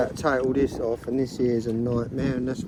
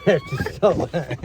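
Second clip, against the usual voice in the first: laughing. Real, not acted. Dad laughing at being filmed.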